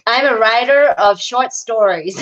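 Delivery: slow, hammed-up American accent. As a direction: overdo the American R's. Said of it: "that's horrible".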